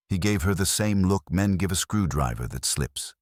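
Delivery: plain reading voice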